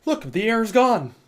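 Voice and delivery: high, emphatic triumph; worked-up and vindicated; hyped up. Real, not acted. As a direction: show off the fix, all fired up. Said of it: "I'm getting hyped up here".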